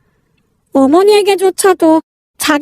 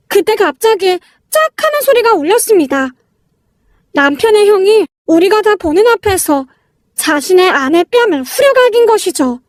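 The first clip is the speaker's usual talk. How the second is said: plain reading voice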